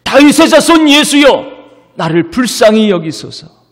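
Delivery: high loud cry easing into a plea; shouted plea. Acted. Blind beggar calling Jesus by name, shouting. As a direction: shout the name, then plead. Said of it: "소리를 지르면서".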